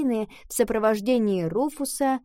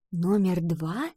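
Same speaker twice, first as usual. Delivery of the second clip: puzzled question; 3 semitones lower, slower than usual. Acted. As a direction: repeat it back, puzzled and hushed. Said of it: "переспросила Ива".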